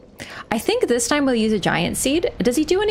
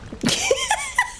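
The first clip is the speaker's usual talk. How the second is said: very high squeaky laugh; 3 dB quieter than usual. Real, not acted. Laughing at the game.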